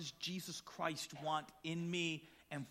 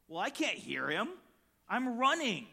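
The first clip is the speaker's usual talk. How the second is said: normal talking voice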